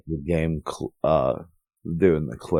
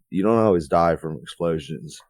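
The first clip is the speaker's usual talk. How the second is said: ordinary talk, nothing marked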